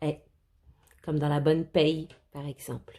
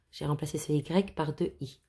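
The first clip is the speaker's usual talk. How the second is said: ordinary talk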